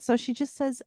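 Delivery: ordinary talk, nothing marked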